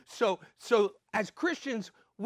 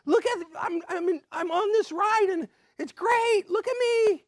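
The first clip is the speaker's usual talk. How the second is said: put-on much higher, much louder thrilled child voice; little ones shouting to parents from the rides; excited grandkids; 14 semitones higher, 8 dB louder than usual. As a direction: squeal it like a kid on a fair ride begging to be watched. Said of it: "they wanna be noticed".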